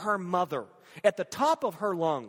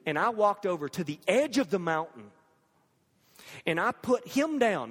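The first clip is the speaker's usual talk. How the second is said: ordinary talk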